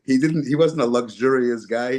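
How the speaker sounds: ordinary talk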